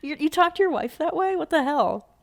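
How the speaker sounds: high, loud incredulous outburst; appalled disbelief. Retold or imitated. Coworker reacting to how he talks to his wife, appalled. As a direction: retell being scandalized, voice jumping up. Retold shocked reaction to rude husband.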